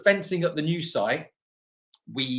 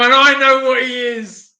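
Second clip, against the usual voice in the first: loud insistence; 10 semitones higher, 12 dB louder than usual. Real, not acted. Insisting loudly over laughter.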